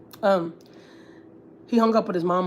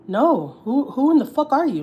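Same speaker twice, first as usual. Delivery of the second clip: higher and louder, fed up; indignant outburst; 6 semitones higher, 3 dB louder, slower than usual. Real, not acted. Venting about her mother-in-law, angry outburst. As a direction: demand it, outraged.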